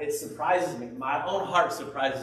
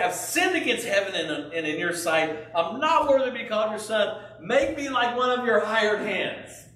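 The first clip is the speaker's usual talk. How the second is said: voicing the son's plan; 7 semitones higher, 4 dB louder than usual. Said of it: "So he's going".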